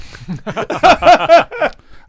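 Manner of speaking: sudden high, loud laugh; loud burst of laughter. Real, not acted. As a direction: crack up loudly at your own slip. Joke about leaving out a person.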